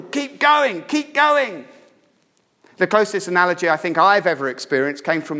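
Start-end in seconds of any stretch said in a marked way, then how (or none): 0.0-1.6 high, forceful urging yell; brutal, high-pitched rallying shout. Acted. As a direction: drive them on relentlessly. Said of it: "It's a brutal".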